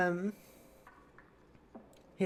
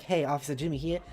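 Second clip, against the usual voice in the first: usual speaking voice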